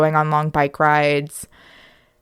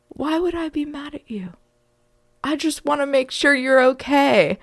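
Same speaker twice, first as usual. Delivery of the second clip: hushed puzzled reassurance, pitched up; reassuring dad; 7 semitones higher than usual. Retold or imitated. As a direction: imitate a father gently soothing a hurt kid. Dad reassuring his crying child.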